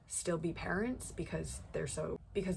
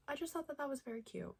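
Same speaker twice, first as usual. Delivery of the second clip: gushing; 8 semitones higher, 5 dB quieter, faster than usual. Real, not acted. Gushing over brother's kindness.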